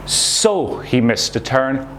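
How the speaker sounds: slow, weighted "So he missed a turn"; stress on So. Shown as a demonstration. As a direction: lean on "So", taking it slowly. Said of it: "put more emphasis on the second part".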